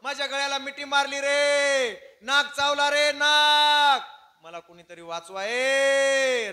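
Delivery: very high, drawn-out shouts for rescue; acted full-force cry for help; screaming for help. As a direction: bellow in panic, stretching each call long. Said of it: "मोठ्यानं ओरडायला लागला".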